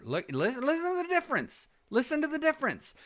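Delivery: high, quick call from a distance; away from the mic. Shown as a demonstration. Coach showing the change in sound, high and distant. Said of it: "notice as I move away".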